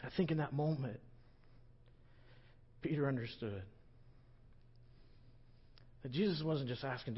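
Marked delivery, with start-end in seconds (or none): none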